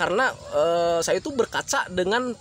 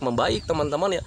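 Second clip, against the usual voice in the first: normal talking voice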